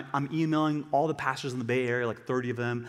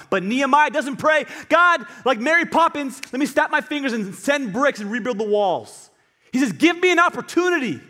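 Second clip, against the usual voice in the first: loud, high-pitched impassioned preaching; building cry that eases at the end; full-force preaching; 10 semitones higher, 8 dB louder than usual. Acted. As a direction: drive it home to the congregation.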